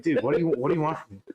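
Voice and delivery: fast, exasperated plea. Retold or imitated. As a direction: throw up your hands, fast. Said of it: "I was like".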